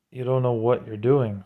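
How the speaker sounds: ordinary talk, nothing marked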